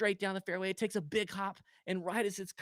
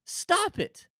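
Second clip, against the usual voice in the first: exasperated high stop it; 13 semitones higher, 4 dB louder than usual. Real, not acted. Scolding golfer.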